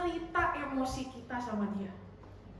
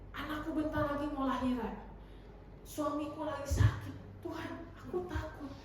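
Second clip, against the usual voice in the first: soft, confiding prayer to God; doa cemas; 6 dB quieter than usual. Acted. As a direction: pour out worries quietly, as if praying alone. Acts out an anxious prayer. (doa lirih orang yang cemas)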